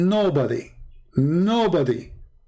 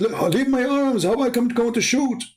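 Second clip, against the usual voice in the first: higher, pleading to be let go; exasperated protest; 10 semitones higher than usual. Retold or imitated. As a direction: push them off his arms. Him protesting being held.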